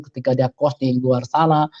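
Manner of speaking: normal talking voice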